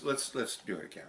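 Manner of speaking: usual speaking voice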